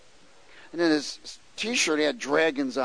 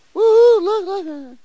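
high, loud mock catcall at girls; leering catcall; 14 dB louder, much slower than usual. Preacher mimicking a gawking driver, loud high catcall. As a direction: hoot it out like a gawking guy.

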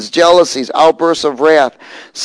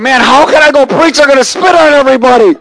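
high, loud mock-panicked outcry; comic alarm, rising loud and high; acted-out dismay; 11 semitones higher, 6 dB louder than usual. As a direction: wail the worry at high pitch, hammed up. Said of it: "And I'm thinking".

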